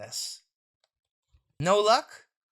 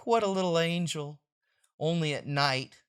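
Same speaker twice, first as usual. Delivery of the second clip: ordinary talk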